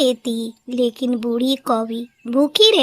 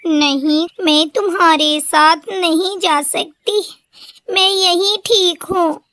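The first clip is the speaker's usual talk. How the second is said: tearful pleading; 5 semitones higher than usual. A narrator's reading. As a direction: ask why she is treated so, near tears. Old crow refuses help. (बूढ़ी माँ की रोती हुई, धीमी गुहार)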